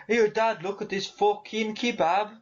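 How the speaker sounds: high, hammed-up Brummie kid; attempted Brummie. Kid showing Dad a find, mock Brummie. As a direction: put on an eager, high Brummie voice. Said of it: "he'd just be more like".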